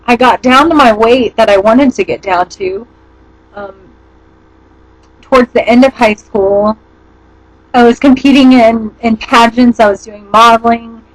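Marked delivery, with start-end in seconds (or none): none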